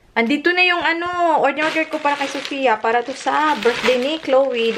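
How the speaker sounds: excited. Real, not acted. Excited reveal of daughter's order.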